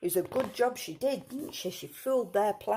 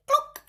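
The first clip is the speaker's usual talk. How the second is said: high, loud, short cluck; sudden high-pitched cluck; hen cluck; 10 dB louder than usual. A narrator's reading. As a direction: imitate a hen with one sharp cluck. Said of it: "And the hen said".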